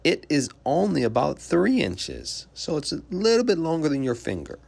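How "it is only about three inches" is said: normal talking voice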